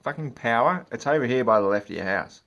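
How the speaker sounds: loud exasperated correction; mock angry correction; annoyed retort. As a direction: snap back at the customer. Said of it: "I said".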